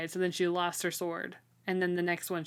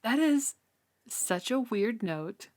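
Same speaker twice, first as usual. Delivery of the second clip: drawn-out, baffled disbelief, rising high; mock outrage at a weird detail; incredulous self-quote; 4 semitones higher, slower than usual. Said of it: "And I'm like".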